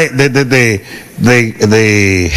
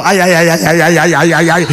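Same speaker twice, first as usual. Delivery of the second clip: mock pain moans.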